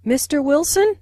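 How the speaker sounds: "Mister Wilson" rising high to address someone; rising intonation. Shown as a demonstration. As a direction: lift the pitch through the end of "Mister Wilson". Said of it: "Notice the rising intonation on".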